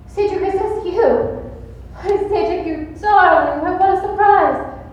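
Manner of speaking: high, gushing delight; gushing surprise. Acted. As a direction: coo over the surprise call. Actress gushing on phone to suitor.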